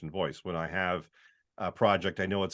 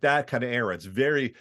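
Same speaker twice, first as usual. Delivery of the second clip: ordinary talk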